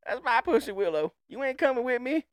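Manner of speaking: high, put-on indignant boy voice; protesting boy. Boy refusing the old man's company, mock indignant. Acts boy guarding his pussy willow.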